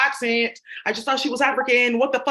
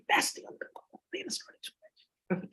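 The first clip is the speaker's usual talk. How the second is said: slow secretive whisper; 9 dB quieter, much slower than usual. Real, not acted. Speaker confides to viewers in a slow whisper. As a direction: let them in on a secret, whispered.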